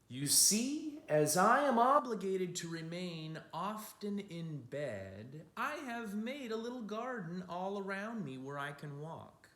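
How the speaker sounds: a hint of an old man's wonder; old Matisse voice. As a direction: speak as the old painter showing his garden. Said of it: "quoted Matisse speech".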